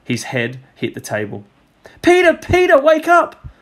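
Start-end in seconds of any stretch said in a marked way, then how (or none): 2.0-3.5 loud, much higher desperate call; Judy yelling at her sleeping brother to wake; crying out. A narrator's reading. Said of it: "cried Judy".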